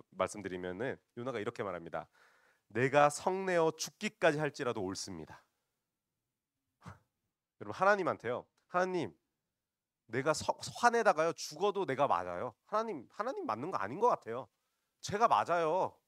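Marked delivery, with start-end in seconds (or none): 2.7-5.4 raised, loud defiant answer; acted brazen defiance; brazen retort. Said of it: "아주 기가 차게 대답을 하거든요". 8.7-16.0 high, loud cheeky protest; acted indignant defiance; defiant back-talk. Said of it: "반항을 하고 있는 것입니다".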